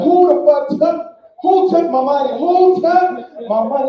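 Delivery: high, loud panicked yelling; mock panic, loud and slow; frantic yelling. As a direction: yell in a frantic search for the thief. High, searching for stolen money.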